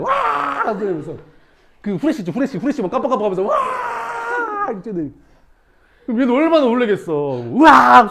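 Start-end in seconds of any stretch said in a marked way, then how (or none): 0.0-0.6 long, high-pitched "waah" scream; high mock scare shout; imitated ghost yell. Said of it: "위에서 소리 지르면 뛰어내려". 3.4-4.7 long, high-pitched "waah" scream; high mock scare shout; imitated ghost yell. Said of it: "소리 지르면 뛰어내려". 7.4-8.1 high, loud "euak" shriek; mock scream of fright; imitated startled scream. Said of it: "소리 지르면서 막 가".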